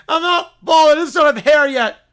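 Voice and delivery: put-on high, very loud childish protest; indignant little kid. Bear teased about being bald, loud indignation. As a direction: protest loudly like an insulted toddler.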